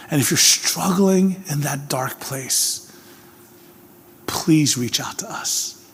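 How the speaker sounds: slow, soft near-whisper; quiet, slow pastoral whisper; hushed appeal. Acted. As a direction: lean in and plead gently.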